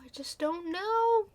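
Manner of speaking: high, drawn-out, exasperated; high pitched. Real, not acted. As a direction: let your voice climb in despair. Helpless, rising.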